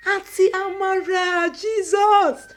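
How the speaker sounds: high, loud startled exclamation; shocked cry. Acted. Niece startled to see her aunt, crying out high. Surprised greeting, running forward.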